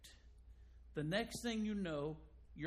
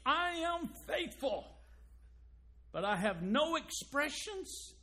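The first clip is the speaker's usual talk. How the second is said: mock proud spouse; 8 semitones higher, 4 dB louder than usual. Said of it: "But if I say".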